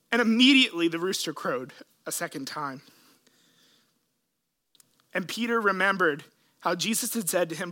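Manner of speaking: ordinary talk, nothing marked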